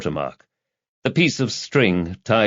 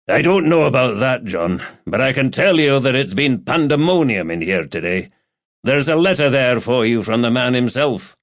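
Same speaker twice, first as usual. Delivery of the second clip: animated, higher-pitched colleague's voice; chatty police colleague Campbell; 4 semitones higher than usual. Acted. Campbell briefing Rebus on the day at the station, animated. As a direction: lift the pitch for a hearty fellow sergeant.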